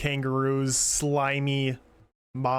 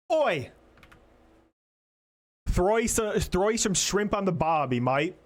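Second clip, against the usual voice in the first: hammed-up Australian, higher pitched; mock Australian accent; 3 semitones higher than usual. Streamer jokes to chat in a mock Australian accent. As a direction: play a cartoon Aussie shouting for shrimp. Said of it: "Doing a little bit of the Australian".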